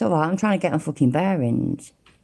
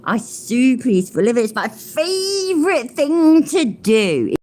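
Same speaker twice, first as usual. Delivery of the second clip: high, slow mocking imitation of his boast; mocking quote; 6 semitones higher, slower than usual. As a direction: lift the voice and stretch it out, sneering. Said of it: "what he used to really say".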